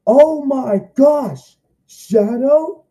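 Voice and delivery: thrilled shock. Retold or imitated. Kid reacting to Shadow appearing, thrilled and loud. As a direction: squeal at your favourite hero's surprise entrance. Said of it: "And I'm like".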